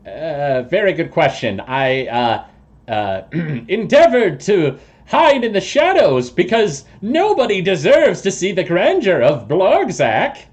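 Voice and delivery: high, grandiose toad-man voice; pompous toad creature. Acted. Toad person explains his hiding to visitors, high and pompous. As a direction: boast loftily as a self-important toad.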